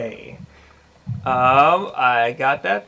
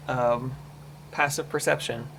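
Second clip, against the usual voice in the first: ordinary talk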